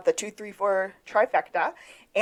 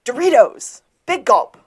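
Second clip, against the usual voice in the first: loud, raised blurting; mock yelling; 5 semitones higher, 7 dB louder than usual. Fake psychic shouting random words to police, mock yelling. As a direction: call out each item like a fake psychic outburst. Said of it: "just starts yelling".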